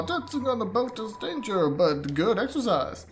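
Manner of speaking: high, loud goofy voice; silly character voice. Pretend. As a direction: play a cheerful little creature. Voicing a boat character.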